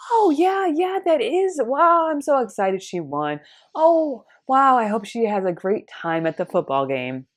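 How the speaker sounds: high, forced cheerfulness; fake enthusiasm. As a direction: pretend to be thrilled through gritted teeth. Forced enthusiasm, secretly bitter.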